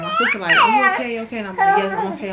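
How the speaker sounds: high, loud teary retelling; real tears in the retelling; emotional crying. Real, not acted. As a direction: voice breaking while telling. Emotional retelling, sister checks on her.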